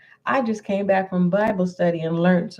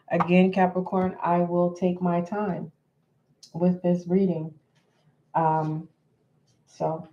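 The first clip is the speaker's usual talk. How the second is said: ordinary delivery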